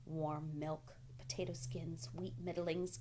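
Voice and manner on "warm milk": ordinary delivery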